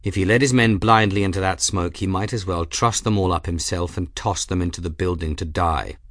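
ordinary delivery

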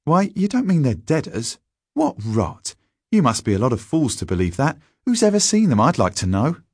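high, scornful schoolboy; disbelieving schoolboy jeer; scornful, bitter. A narrator's reading. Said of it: "Stanley was scornful and bitter".

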